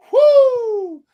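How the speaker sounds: a high, loud release whoo; venting whoop. Real, not acted. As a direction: let off steam with a big whoo. Venting after rant.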